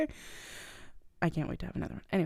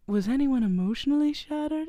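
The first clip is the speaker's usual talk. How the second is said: higher-pitched, put-on villain asking eagerly; imitating the Grinch; 6 semitones higher than usual. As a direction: ask in a raised character voice. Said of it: "he's like".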